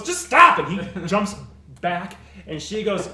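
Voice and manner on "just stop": yelled much higher and louder; exasperated shout. Acted. Grim shouts at the pestering woman, exasperated yell. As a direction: snap at her to quit it.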